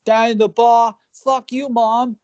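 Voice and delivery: hammed-up high shouting English dude; yelling English singer. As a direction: play a drunk lad shouting at everyone. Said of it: "It's like him just going".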